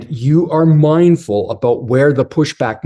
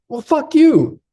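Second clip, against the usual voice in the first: much higher, hammed-up blurt of anger; mock angry outburst; 14 semitones higher than usual. Husband lashing out at his wife before storming off, mock angry. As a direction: fling it out like a sulking boy storming off.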